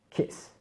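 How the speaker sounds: kiss said softly, the i kept short; don't lean on the i in kiss; short i, quiet. Shown as a demonstration. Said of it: "il faut vraiment pas insister là-dessus". (kiss, i court et doux)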